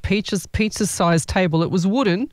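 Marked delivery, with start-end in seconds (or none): none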